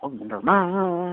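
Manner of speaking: very slow, drawn-out mock echo; playful echo. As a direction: stretch the name out teasingly. Listener playfully echoes the last name.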